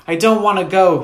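put-on high, loud childish wail of refusal; cried out. A narrator's reading. Sister resisting as brother tugs her arm, cried out. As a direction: wail the refusal, pitched right up. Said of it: "she cried again".

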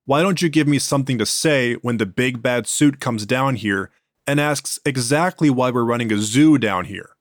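plain reading voice

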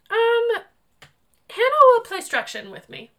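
put-on high, loud little bossy boy; commanding toddler. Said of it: "So commanding".

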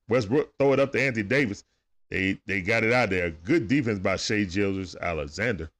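quick running game commentary; live play-by-play. Acted. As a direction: call the game as it happens.

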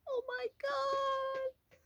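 very high, soft, slow drawn-out cry; wailing dismay. Real, not acted. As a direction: stretch it out in dismay. Reacting to Charrington reveal.

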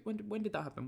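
high, incredulous question; mock disbelief. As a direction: act like it never happened. Mock pretending season never happened.

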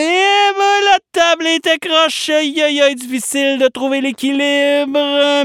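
high strained voice losing balance; teetering on a crooked table; wobbly struggling voice. Acted.

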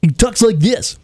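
hammed-up jock shouting call letters; Boomer impression. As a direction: stretch out the call letters like a cheesy DJ. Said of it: "that's exactly how he says stuff".

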